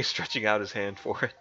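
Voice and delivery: plain reading voice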